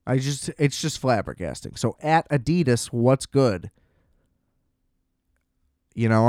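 usual speaking voice

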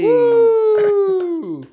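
high mock woo; a sarcastic little cheer. Real, not acted. Friend announces surgery, high mock cheer. Cheering wisdom teeth news.

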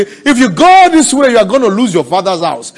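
loud, high-pitched shouted warning; shouted, high, urgent imitation of doubters; warning naysayers. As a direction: cry it out as an alarmed challenge. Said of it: "people will dangle and say".

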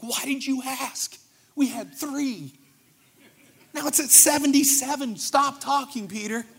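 annoyed disciples. Retold or imitated. Disciples scolding Peter for asking, high exasperated whine. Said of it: "They're like".

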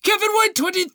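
very high, hammed-up announcing voice; mock announcer. Co-host retrying a reviewer's shout-out, high mock announcer. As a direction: call the name out high and showy.

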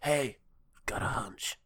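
hushed, breath-only gunman voice; playing a quiet cowboy; mock furtive murmur. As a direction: say it under your breath. Acted Wild West gunman's hunch.

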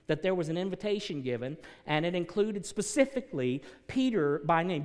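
loud, rising pulpit preaching; preaching at full force. Acted. Pastor preaching hard to his congregation, loud and rising. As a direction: drive it home to the congregation.

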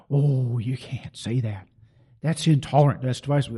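imitating a pearl-clutching churchgoer; mock critic. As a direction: sneer it out like a whiny objector. Mocking critics' objection.

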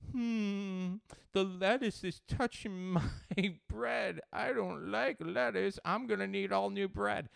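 high, loud, slow mock whine; kid mimicking fussy eater. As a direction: drag out the child's fussy complaint up high. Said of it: "Aiden, that son of a bitch".

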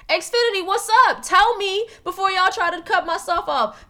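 high and loud "Xfinity, what's up?"; exasperated rant. Real, not acted. Ranting at the cable company about the cut-off, loud and high. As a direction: call out the company in frustration.

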